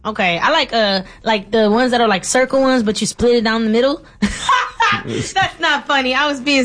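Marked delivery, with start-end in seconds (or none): 4.1-6.7 laughing, slow. Real, not acted. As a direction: laugh it out slowly. Laughing at himself.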